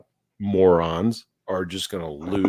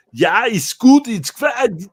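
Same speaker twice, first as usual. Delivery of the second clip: loud, high German guy voice; German accent; 12 semitones higher, 8 dB louder, slower than usual. Retold or imitated. German campers heard in background, mock German accent. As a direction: boom it like a jolly German camper. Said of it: "I hear in the background".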